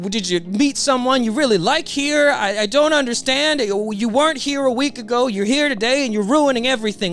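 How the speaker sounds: high, stammering plea; acted villain pleading in bewilderment; desperate begging. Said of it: "he is just begging Luffy to give him any sort of an explanation".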